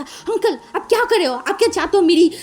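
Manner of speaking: put-on bratty kid telling off an adult; bossy child voice. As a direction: scold the uncle like a bossy child. Child scolds policeman, higher voice.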